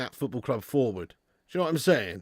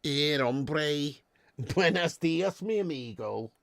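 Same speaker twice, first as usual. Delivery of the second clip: a lightly put-on Spanish-Scouse accent, slow; Cuban Scouser; 4 dB quieter, slower than usual. Streamer voicing a Cuban Scouser viewer, mock Spanish-Scouse accent. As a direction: play a Cuban Scouser, hamming it up gently. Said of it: "somebody who's like".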